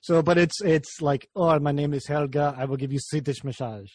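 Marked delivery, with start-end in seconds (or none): 1.3-3.9 lightly put-on Swedish accent, barely off his own voice; a half-hearted, bad Swedish accent for a stern masseuse; terrible Swedish accent. Car guy jokes about masseuse Helga in a mock Swedish accent. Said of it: "That's a terrible Swedish accent".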